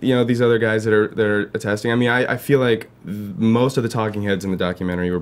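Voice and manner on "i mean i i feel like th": ordinary talk, nothing marked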